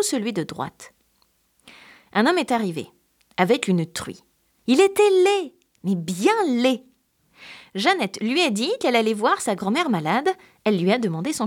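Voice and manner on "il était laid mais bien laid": stretched, weighted "mais bien laid"; mock horror. A narrator's reading. Storyteller stressing how ugly the man is. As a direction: lean slowly on "bien laid". Narrator stresses the man's ugliness.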